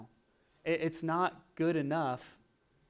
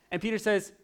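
ordinary delivery

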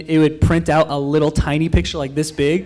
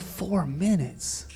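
soft, slow, stunned question; hushed disbelief; 12 dB quieter, much slower than usual. Retold or imitated. Exhausted rider looking at the clock, stunned and hushed. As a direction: drop to a small, drawn-out voice.

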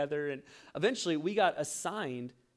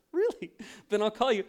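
much higher, cocky dare; mock challenge; 6 semitones higher, 5 dB louder than usual. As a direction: toss back a proud challenge. Retold challenge, pride rising.

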